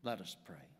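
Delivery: ordinary talk, nothing marked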